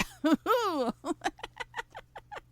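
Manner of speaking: high giggle after a tease; naughty laugh. Real, not acted. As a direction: giggle at your own innuendo.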